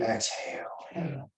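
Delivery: breathy whispered cue; whispered exhale. Shown as a demonstration. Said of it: "counting breath cue".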